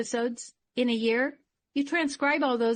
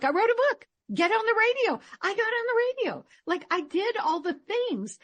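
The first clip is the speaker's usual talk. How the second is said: excited; 10 semitones higher than usual. Real, not acted. Excitedly recounting her achievements.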